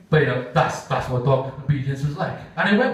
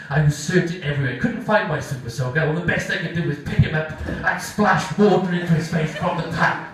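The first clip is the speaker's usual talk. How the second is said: normal talking voice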